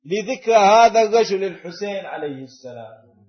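a loud imitated critic; challenging question. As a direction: throw the question back like the critic. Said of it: "يوجه لك هذا السؤال".

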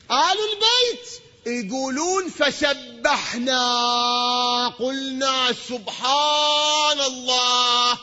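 high, slow, ringing chant of praise; preaching at full force. Acted. Preacher to his congregation about praising God, chanting high and slow. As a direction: lift each praise word out to the hall.

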